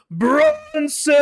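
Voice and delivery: booming shout across the tavern; bellowing a name. Acted. Innkeeper bellows across the tavern for the champion, booming. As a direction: bellow the name to the whole room. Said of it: "he just bellows out into the tavern".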